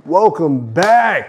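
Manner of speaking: loud, high, drawn-out greeting; big welcome. Pretend. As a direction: stretch it out like a show host's return. Host greets viewers after the monologue.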